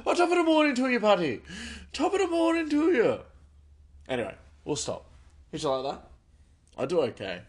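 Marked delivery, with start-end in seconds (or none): 0.1-3.2 loud, very high Irish shout; exuberant Irish accent. Retold or imitated. Bus driver replying to the pranksters, exuberant Irish accent. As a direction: bellow a merry Irish greeting back. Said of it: "speaking like Irishmen".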